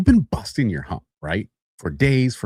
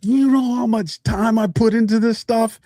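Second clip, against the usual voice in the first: high-pitched acted indignation; pissed off; 12 semitones higher than usual. Worker angry over wasted effort, acted pissed-off rant. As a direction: rant in mock outrage at the boss. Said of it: "You're pissed".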